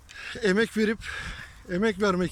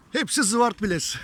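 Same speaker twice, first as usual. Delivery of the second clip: calling out. Real, not acted. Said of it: "Tek tek bağıralım".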